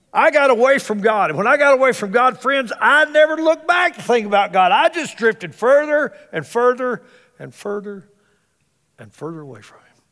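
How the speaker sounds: rising, loud preacher's build then drop; preaching at full force. Acted. Preacher warning his congregation, loud build fading to hush. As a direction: thunder it out, then fall away to a hush.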